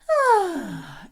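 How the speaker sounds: high, loud "ah" gliding down; yawning sigh. Shown as a demonstration. Coach explains the stretch for the vocal folds, yawning sigh. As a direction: sigh out an open-throated, falling "ah". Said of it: "we're doing the yawn".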